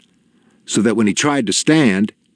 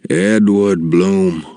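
put-on old doctor musing; 3 dB louder, much slower than usual. A narrator's reading. Old doctor speaks to no one.

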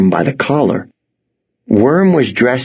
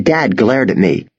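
ordinary delivery

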